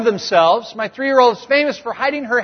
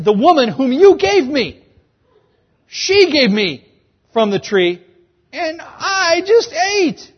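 higher, whiny Adam passing the blame; mock blaming whine; 6 semitones higher, 3 dB louder, slower than usual. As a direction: whine that it's all her fault. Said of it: "dripping with, it's her fault".

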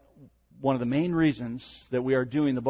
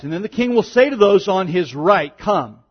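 raised, solemn reading voice; scripture reading; 5 semitones higher, 8 dB louder than usual. Acted. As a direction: proclaim the passage to the congregation. Reads Matthew 25 aloud.

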